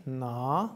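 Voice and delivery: Na with a long, held vowel. Shown as a demonstration. Teacher showing the mantra's first long A, Na held long. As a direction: draw out the A in Na. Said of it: "the first A is long".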